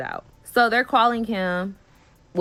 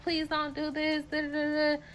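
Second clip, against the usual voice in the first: mock pleading; 7 semitones higher, 7 dB quieter than usual. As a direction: whine it like the clingy friend. Friend begging, voiced high.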